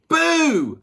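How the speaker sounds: loud high playful BOO; cub's shout. A narrator's reading. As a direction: burst out with a boo. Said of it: "shouted the little polar bear".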